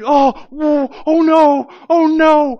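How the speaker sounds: high, loud panicked "oh no"; hammed-up frightened moans; freaking out. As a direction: cry out in sudden panic. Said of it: "he freaks out".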